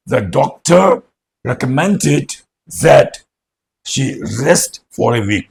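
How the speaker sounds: slow, deliberate dictation; slow model sentence. Shown as a demonstration. Teacher repeating the example sentence slowly. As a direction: read it out slowly so students copy it. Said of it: "गलती ना करें".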